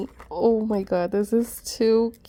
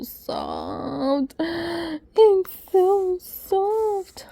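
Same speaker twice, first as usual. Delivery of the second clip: cooing; 7 semitones higher, much slower than usual. Real, not acted. Stroking soft blanket.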